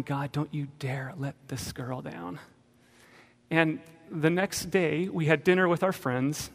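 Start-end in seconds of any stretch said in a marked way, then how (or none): 0.0-2.4 ticked-off prayer. Retold or imitated. Father praying for his daughter's faith, quietly ticked. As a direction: mutter a stern challenge, keeping it quiet. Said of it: "I'm kinda ticked".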